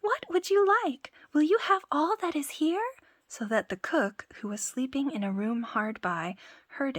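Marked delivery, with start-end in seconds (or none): 0.0-2.8 high, loud shout; loud cry. Acted. Tom Thumb shouts to the thieves again, high and loud. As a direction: shout it again to wake them. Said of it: "cried out again".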